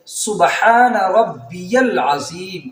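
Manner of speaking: سبحان ربی العظیم slow, ربی in full; correct recitation. Shown as a demonstration. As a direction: hold each syllable of سبحان ربی العظیم. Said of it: "رکوع والی تسبیح پڑھی جاتی ہے". (صحیح آہستہ تسبیح)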